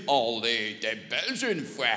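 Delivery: a smug French guy doing his Belgian joke; the know-it-all Frenchman, low and drawled; mocking Frenchman. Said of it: "ce genre de Français qui se sent systématiquement obligé de nous dire".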